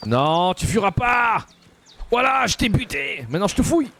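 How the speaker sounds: high gloating yell; triumphant shout. Real, not acted. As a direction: crow over a beaten foe. Killing and looting the enemy.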